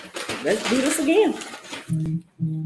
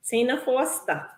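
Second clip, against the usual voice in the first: "Tina Foster" in her home accent, softer; accent slipping out; 8 dB quieter than usual. Real, not acted. Host calling a giveaway winner, her accent coming out. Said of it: "All that accent came out".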